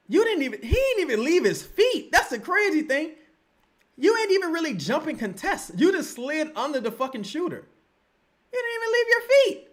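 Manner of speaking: high-pitched, exasperated voice; squealing fan outrage; incredulous complaint. Real, not acted. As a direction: plead it high in disbelief. Incredulous at the careless foul.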